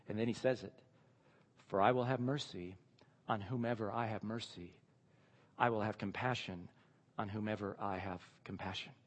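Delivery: lightly voiced God, quiet and measured; God speaking. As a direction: speak as God gently refusing Moses. Voices God answering Moses.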